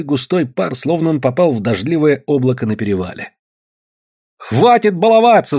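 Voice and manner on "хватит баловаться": high, loud scolding cry; sharp raised-voice order; shouting. A narrator's reading. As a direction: yell at them to quit, pitched high. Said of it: "закричала Татьяна".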